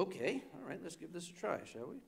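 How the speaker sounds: normal talking voice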